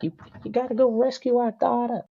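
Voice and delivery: very high-pitched put-on Eskimo accent; hammed-up wife in a joke Eskimo accent; high, sustained mock Eskimo accent. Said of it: "that's my Eskimo accent".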